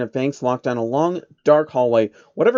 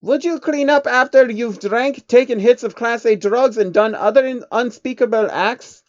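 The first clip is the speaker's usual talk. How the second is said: higher, louder mocking retort; character voice; 10 semitones higher, 6 dB louder than usual. A narrator's reading. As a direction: toss the question back.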